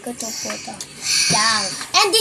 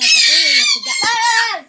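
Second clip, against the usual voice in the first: sudden shout; 5 dB louder than usual. Real, not acted. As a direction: voice jumps high and loud. Sister interrupted by noisy kid.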